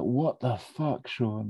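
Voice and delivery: mild mock anger. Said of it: "Like this".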